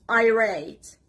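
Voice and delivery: "irate" stressed on RATE, from the R; stress on second syllable. Shown as a demonstration. Teacher modelling a new word for learners, second syllable stressed. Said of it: "stress is on the letter R and the second syllable".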